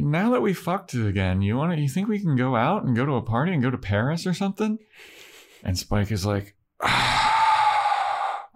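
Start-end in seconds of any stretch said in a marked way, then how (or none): 0.0-4.8 high, quick mock-eager pleading; eager wheedling. Imitating Harmony asking Spike out, eager wheedle. 6.8-8.5 long voiceless exasperated sigh; exasperated groan. Retold or imitated. Imitating Spike annoyed by Harmony, exasperated groan.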